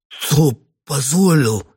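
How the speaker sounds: strained hoarse whisper. A narrator's reading. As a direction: squeeze it out hoarsely. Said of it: "сипло выдавил Мадинский".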